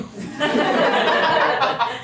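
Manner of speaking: squealing laugh breaking into a high pitch; high burst of laughter. Real, not acted. Son cracking up at the diners he imitates, squealing laugh. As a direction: laugh hard at your own joke. Laughing at his own impression.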